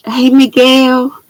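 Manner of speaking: ordinary talk, nothing marked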